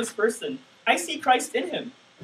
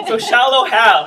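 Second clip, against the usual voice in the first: laughing; 9 dB louder, slower than usual. Real, not acted. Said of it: "I knew I said something wrong".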